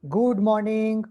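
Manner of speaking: affected drawl of a prim teacher; sweet drawl. Shown as a demonstration. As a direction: drag out the words with fake sweetness. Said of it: "that is drawl".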